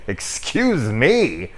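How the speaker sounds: slow, drawn-out mock indignation; sarcastic kid. Kid snubbed after helping, slow sarcastic retort. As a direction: stretch it out, offended. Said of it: "the kid's like".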